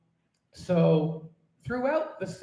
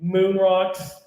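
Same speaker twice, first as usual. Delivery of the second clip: mock-angry ode; 3 semitones higher, 3 dB louder than usual. Poet curses the moon in her ode, mock-angry rant. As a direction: insult the moon with comic indignation.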